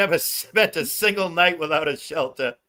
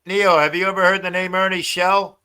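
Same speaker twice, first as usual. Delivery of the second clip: ordinary delivery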